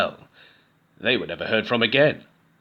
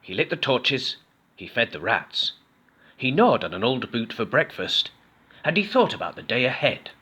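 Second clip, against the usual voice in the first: plain reading voice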